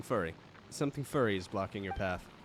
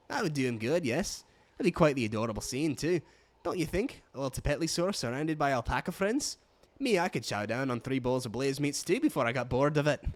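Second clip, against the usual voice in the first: put-on Scottish brogue slipping into another accent; loosely Scottish alpaca keeper; wobbly Scottish accent; 2 semitones higher than usual. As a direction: do an amateur Scottish keeper, drifting off it. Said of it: "that leaned out of Scottish".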